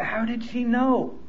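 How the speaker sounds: slow, soft wondering to himself; hushed puzzled musing; quiet inner thought. Retold or imitated. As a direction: ask it under your breath, slowly. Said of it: "I thought".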